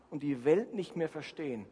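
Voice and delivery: ordinary talk, nothing marked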